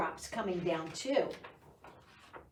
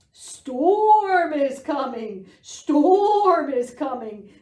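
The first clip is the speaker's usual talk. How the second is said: loud, slow, higher panicked sheep cry; bleating sheep; 5 semitones higher, 10 dB louder, slower than usual. Acted. Sheep warning the farm animals, loud slow bleat. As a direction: bleat the alarm out slowly and loudly. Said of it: "the sheep bleated".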